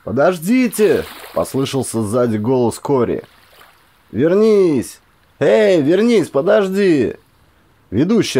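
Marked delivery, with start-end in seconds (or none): 0.1-1.0 pleading call from behind. A narrator's reading. Corey calls after them. 4.1-7.2 high, loud calls to come back; desperate call to wait. A narrator's reading. Corey calls them back.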